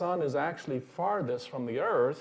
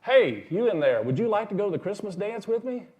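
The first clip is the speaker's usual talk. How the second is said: calling in; 7 semitones higher, 5 dB louder than usual. Retold or imitated. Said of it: "sticks his face down in the little house and says".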